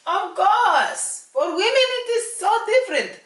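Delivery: put-on loud, high Russian accent. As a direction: play it up as a Russian speaker. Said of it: "That's my full Russian accent".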